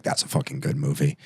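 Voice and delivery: very low, quiet mock approval; imitated moviegoers. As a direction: parody their praise down low. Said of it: "everyone goes like".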